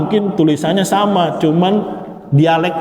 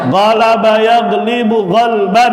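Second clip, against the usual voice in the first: recitation drill; 6 semitones higher, slower than usual. Shown as a demonstration. Teacher reciting the masdar gallery aloud, chanting. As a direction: recite each pattern clearly for the class.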